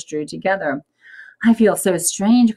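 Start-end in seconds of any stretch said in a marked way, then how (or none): 1.4-2.6 higher, louder alarmed cry; Adam frightened by his shrinking body. A narrator's reading. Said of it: "cried Adam".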